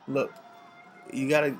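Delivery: ordinary talk, nothing marked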